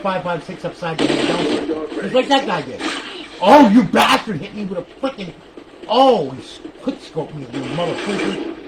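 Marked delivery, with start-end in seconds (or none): none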